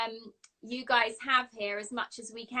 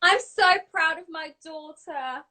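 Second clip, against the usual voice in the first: higher, louder, slowing into a hammed-up proud dad; mock proud movie father; 3 semitones higher, 8 dB louder than usual. Co-star's proud movie father praising his daughter, hammed-up proud voice. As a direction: play the beaming father from the film, a bit over the top. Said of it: "plays my father in the movie".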